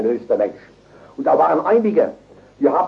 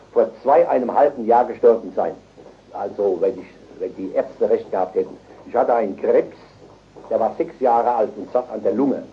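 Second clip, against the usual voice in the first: ordinary delivery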